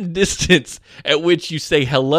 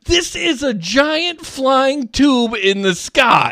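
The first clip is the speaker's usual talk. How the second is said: incredulous; 11 semitones higher, 4 dB louder, much slower than usual. Retold or imitated. Inner thought during plane fight.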